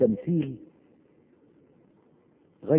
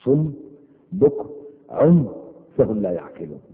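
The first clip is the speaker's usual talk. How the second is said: raised, chanted verse recitation; Quran recitation; 4 semitones higher, 4 dB louder than usual. Acted. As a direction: intone the verse like tajweed.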